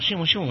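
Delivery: high, playful "shyung" pistol noises; imitated gunshots. Armed student answers lecturer over a missing grade, playful gunfire noises. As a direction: mouth two cartoon pistol shots.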